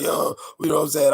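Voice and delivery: high, loud hype-man call; hype rapper voice. Shown as a demonstration. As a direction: pump it up like a hook ad-lib. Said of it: "I'm like".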